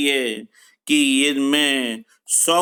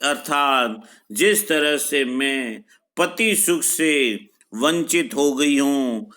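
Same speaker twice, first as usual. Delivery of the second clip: ordinary delivery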